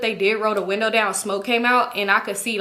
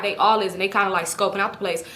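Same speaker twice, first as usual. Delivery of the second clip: normal talking voice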